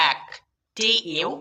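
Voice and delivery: normal talking voice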